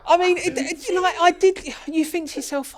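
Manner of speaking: laughing through the words. Real, not acted. Teased by the host about fixing it, laughs while talking. Laughs after host's teasing.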